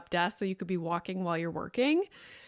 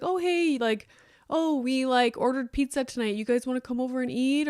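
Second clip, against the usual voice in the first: bright, higher casual invitation; cheerful friend's invite; 4 semitones higher than usual. Pretend. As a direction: call out like a friendly neighbour. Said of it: "it was just like".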